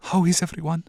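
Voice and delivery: ordinary delivery, nothing marked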